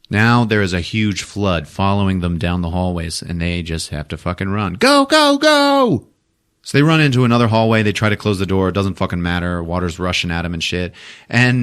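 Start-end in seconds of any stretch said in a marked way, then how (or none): 4.7-6.1 high-pitched urging cry; urgent mock yell. Characters flee the flood.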